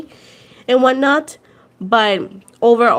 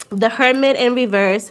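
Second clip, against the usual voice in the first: usual speaking voice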